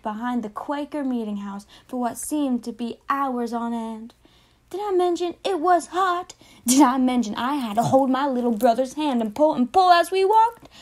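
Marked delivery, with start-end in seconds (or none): 4.7-10.7 exasperated complaint. Acted. Child complaining about the heat.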